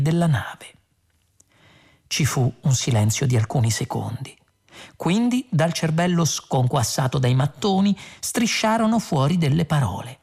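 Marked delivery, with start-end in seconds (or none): none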